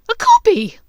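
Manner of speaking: high, loud scornful yell. Acted. Bellatrix yelling at Hermione in scorn. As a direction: scoff out loud. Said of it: "Bellatrix yelled".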